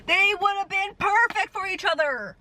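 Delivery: high, stretched-out protest; exasperated lament. Real, not acted. Vlogger ranting over a doomed TV couple, exasperated lament.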